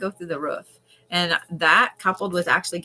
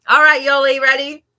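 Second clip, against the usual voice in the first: loud, high summons; big raised-voice cue; calling out; 7 semitones higher, 11 dB louder than usual. Real, not acted. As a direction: call them up to start, rising. Calling viewer to start reading.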